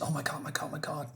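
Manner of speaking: hushed, whispered exclamations in a retelling; acted shocked whisper. Woman shaken after winning her first fight, stunned whisper.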